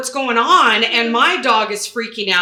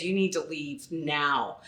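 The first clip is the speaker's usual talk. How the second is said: low, slow, quiet order; firm warning; 5 semitones lower, 8 dB quieter, slower than usual. Retold or imitated. Former cop ordering the man out, quietly commanding. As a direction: give a measured command, weight on each word.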